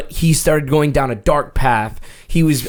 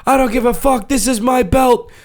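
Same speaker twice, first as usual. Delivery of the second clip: high, defiant wrestler yell; defiant promo, stretched at the end; Rob the Slob; 8 semitones higher than usual. Retold or imitated. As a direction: imitate a heel wrestler taunting the crowd. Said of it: "the Rob the Slob goes".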